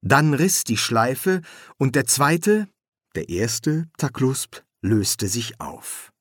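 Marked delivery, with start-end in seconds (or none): none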